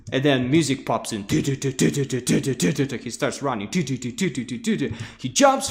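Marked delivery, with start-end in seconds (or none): none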